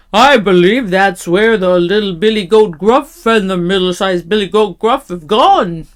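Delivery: loud, weighty big goat. A narrator's reading. Big goat wondering about his brothers, loud voice. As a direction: think aloud as a big billy goat.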